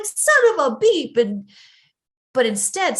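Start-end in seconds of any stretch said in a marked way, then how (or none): 0.0-1.2 higher, louder road-rage curse; angry driver. Retold or imitated. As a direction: blurt it at the swerving car. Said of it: "I'm like".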